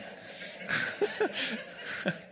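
airy, breathless laugh with no voice; silent laugh. Real, not acted. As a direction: wheeze with laughter. Laughing at his own joke.

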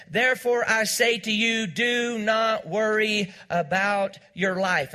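slow, weighted scripture reading; slow solemn emphasis; emphatic reading. Acted. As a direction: let each word land slowly. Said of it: "Notice what he said".

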